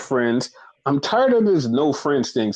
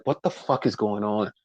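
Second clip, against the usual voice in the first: mock bewildered; 4 semitones lower, 6 dB quieter than usual. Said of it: "standing there like".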